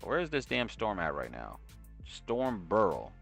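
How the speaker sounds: ordinary talk, nothing marked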